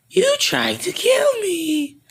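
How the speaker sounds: very high, slow creature squeal; hammed-up squealing monster threat; Boo. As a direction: draw it out in a high cartoon voice. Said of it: "I'm Boo".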